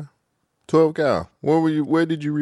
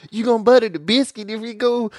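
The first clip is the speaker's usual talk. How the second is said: put-on Southern accent; 6 semitones higher than usual. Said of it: "turn your Southern accent down".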